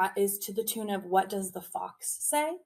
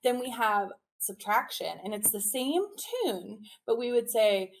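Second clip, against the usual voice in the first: ordinary delivery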